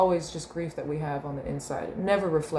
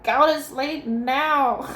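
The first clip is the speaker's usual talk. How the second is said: exhausted parent; 8 semitones higher, 5 dB louder, much slower than usual. Retold or imitated. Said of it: "I was like".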